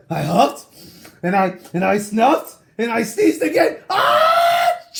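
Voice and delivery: huffing into an explosive sneeze; building into a sneeze. Acted. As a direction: let the sneeze take over.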